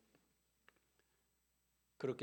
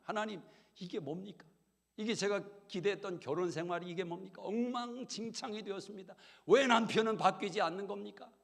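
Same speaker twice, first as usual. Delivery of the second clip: higher, louder complaint building to a cry; upset prayer; 8 semitones higher, 6 dB louder than usual. Retold or imitated. Wife complaining to God about her drunk husband, rising upset. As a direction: pour out frustration to God.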